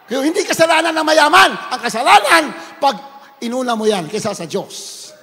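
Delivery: loud, rising preacher's call ending in a shouted Hallelujah; rally the church to get back up; preaching at full force. Acted. Preacher urging sinners to rise, full-force preaching.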